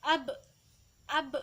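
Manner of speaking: "Ab" with the b bounced off the lips; memantul. Shown as a demonstration. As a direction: release "Ab" with a short bouncing b. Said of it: "harus dibaca memantul". ("Ab" dengan ba memantul)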